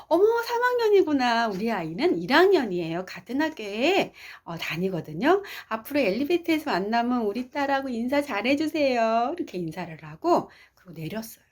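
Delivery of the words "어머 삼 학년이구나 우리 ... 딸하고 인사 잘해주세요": high, delighted mother chatting; pleased mother. Retold or imitated. Mother asking an older student to greet her daughter, high and pleased. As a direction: gush politely like a pleased mom. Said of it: "엄마가 마음이 좋아져서".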